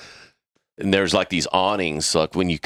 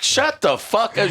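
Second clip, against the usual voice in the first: mock yell; 13 semitones higher than usual. Heated argument with HOA lady.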